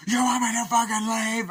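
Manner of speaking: villain screaming in her face; furious scream. Retold or imitated. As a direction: explode at her in rage.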